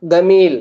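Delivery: கமீல் opening with a hard G as in "go"; Egyptian hard G. Shown as a demonstration. Teacher showing how Egyptians say beautiful, கமீல் with hard G. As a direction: say கமீல் with G replacing the J. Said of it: "கைனுடைய sound".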